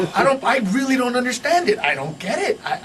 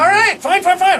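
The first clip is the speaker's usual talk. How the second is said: higher, louder exasperated surrender; exasperated giving in; 6 semitones higher, 4 dB louder than usual. Retold or imitated. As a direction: give in with a sudden higher burst. Doctor gives in to patient's demand.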